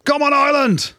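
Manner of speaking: high, loud cheer for Ireland; rallying shout. Real, not acted. Pundit cheering his team on, loud rallying shout. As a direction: belt it out like a fan in the stands.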